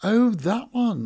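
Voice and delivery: high, loud, drawn-out realisation; overdone casual "oh, him"; feigned recognition. A narrator's reading. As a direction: act as if it has just dawned on you. Pretends sudden recognition to cover lie.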